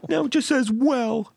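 a squeaky creature complaining; pitiful high mock whine. Voicing a pet or waiting figure.

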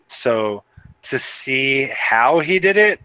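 ordinary talk

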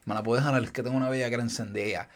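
low, soft imitation of a creep; lightly voiced creep asking the father for a favor; mock creepy request. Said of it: "y dice".

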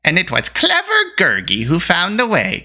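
high, boastful creature voice; self-satisfied creature boast; Gurgi, proud. A narrator's reading. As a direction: pipe up proudly as a little beast. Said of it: "Gurgi put in proudly".

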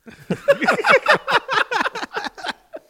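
very high loud nasal cartoon snicker; Butthead laugh. A friend's cartoon laugh, imitated very high and loud. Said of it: "he'd be like".

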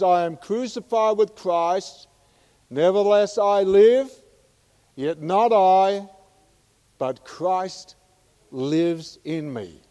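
slowing to a hushed end on lives in me; fervent preaching. Acted. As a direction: declare it like a triumph. Quoting Paul with fervour.

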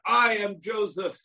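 high, slow booming reveal; dramatic shout. Acted. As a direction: proclaim it like a thunderclap revelation. Brothers suddenly hear Joseph's revelation.

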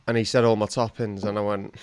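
usual speaking voice

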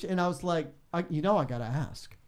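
ordinary talk

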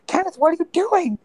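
high-pitched, incredulous cry; dismayed kobold. Acted. Kobold calling to his charmed friend Kenneth, dismayed. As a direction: wail it in squeaky mock dismay.

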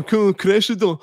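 high, loud schoolyard jeer; bully voice. Retold or imitated. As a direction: sneer it like a playground bully. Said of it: "that's how stupid child insults are".

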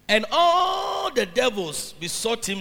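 loud, slow, rising scripture proclamation; preaching at full force. Acted. Preacher reads the Bible story to the church, loud and slow. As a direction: thunder the verse at the congregation.

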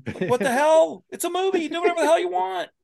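very high, worked-up protest; exasperated plea. Real, not acted. Telling filmmakers to have fun with Captain America, exasperated plea. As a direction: argue it in rising, incredulous exasperation. Riled plea for bolder films.

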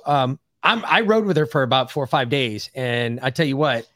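ordinary delivery

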